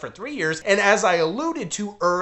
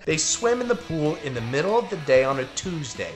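normal talking voice

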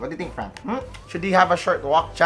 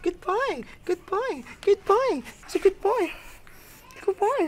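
praising the dog; 4 dB quieter than usual. Real, not acted.

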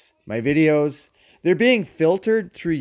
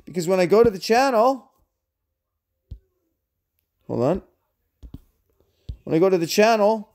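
ordinary talk, nothing marked

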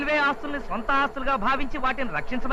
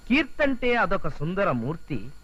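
slow, lilting verse reading; poem recitation; 5 semitones lower, slower than usual. Acted. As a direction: recite your own love poem slowly. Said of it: "చదువుతాను చూడు".

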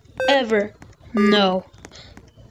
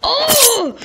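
surprised; 9 semitones higher than usual. Real, not acted. Player startled by something in game, sharp surprised cry. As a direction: blurt it in shock.

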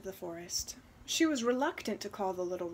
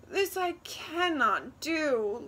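crying out; 5 semitones higher, 5 dB louder, slower than usual. Acted. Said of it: "Luba cried".

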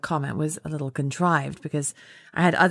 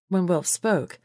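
usual speaking voice

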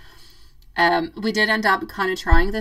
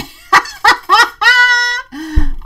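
high, loud real laughter; 10 dB louder than usual. Real, not acted. Reacting to a viewer's joke about being hot, laughing loudly. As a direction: crack up at the comment.

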